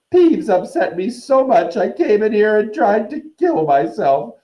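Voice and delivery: high, loud ghostly howl. Acted. Myrtle howling at Hermione about her misery, loud and high. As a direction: wail it to the rafters.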